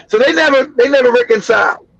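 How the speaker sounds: normal talking voice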